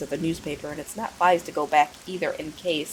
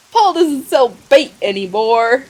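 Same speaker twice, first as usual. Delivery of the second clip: higher, slower, lightly voiced simpleton; 7 semitones higher, 9 dB louder, slower than usual. [name] greeting the stranger, lightly voiced simpleton. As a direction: play him a bit slow and eager.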